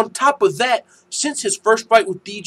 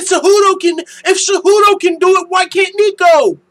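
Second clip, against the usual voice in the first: high, loud mocking troll voice; 11 semitones higher, 8 dB louder than usual. Mocking a chat troll's argument, loud and high-pitched. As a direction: mimic the troll's dumb claim. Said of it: "He said".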